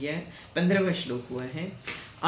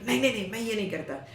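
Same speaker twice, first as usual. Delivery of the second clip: rapid, higher-pitched mock protest; agitated childlike refusal; 5 semitones higher, much faster than usual. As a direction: imitate an irritated child refusing in a rush. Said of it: "जैसे बच्चे कुछ करते हैं तो एकदम ऐसे". (चिढ़े बच्चे की नकल में झटपट इनकार)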